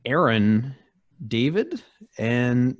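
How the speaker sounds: ordinary talk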